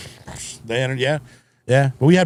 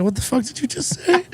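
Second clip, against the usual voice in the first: high-pitched, laughing disbelief; mock incredulous; 10 semitones higher, 6 dB quieter than usual. Retelling his reply to the homie's nickname, mock incredulous. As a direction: act out a baffled, high-voiced comeback.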